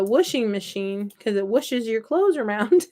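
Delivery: "washing" said as whooshing; childhood whooshing machine. Shown as a demonstration. Crafter shares her childhood word, "washing" as whooshing. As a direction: say "washing" with the vowel of push. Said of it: "I called it a".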